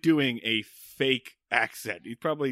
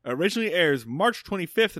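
ordinary talk

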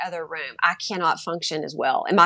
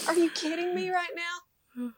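much higher, soft incredulous mock disbelief; 10 semitones higher, 6 dB quieter than usual. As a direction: pretend disbelief at the husband, pitched up. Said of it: "I'm like".

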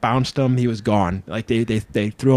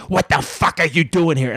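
higher, confrontational put-on voice; Sandman; 4 semitones higher than usual. Drunk wrestler challenging a newcomer in the locker room, raised aggressive voice. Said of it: "this is me doing my Sandman".

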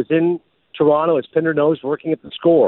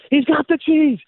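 higher-pitched, hyped sportscaster announcer read; crazed announcer; 9 semitones higher than usual. Retold or imitated. Ad announcer pitching healthy snacks, crazed announcer hype. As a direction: sell the granola like a game-winning play. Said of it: "Just a crazed announcer".

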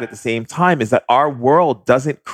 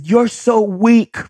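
much higher, slow, sing-song taunt; high mocking taunt; 13 semitones higher, much slower than usual. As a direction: tease the spirits from up high, drawn out. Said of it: "I said".

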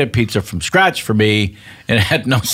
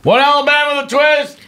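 high sing-song order call to kitchen; 14 semitones higher than usual. Acted. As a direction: announce it to the kitchen. Worker calls the order out.

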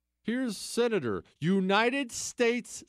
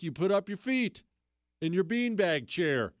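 usual speaking voice

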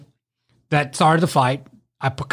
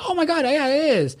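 excited; 9 semitones higher than usual. Retold or imitated. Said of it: "I was like".

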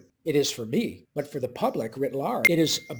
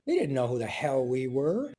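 usual speaking voice

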